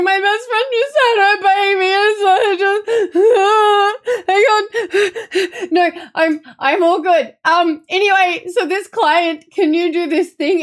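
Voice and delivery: high, wobbly voice fighting back tears; tearful, choked up. Retold or imitated. Calling a coworker about a client while crying, teary and high. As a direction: push through the call while crying.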